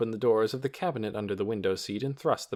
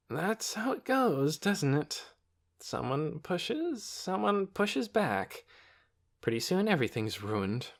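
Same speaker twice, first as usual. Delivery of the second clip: weary; 8 semitones higher, slower than usual. A narrator's reading. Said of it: "in a weary voice".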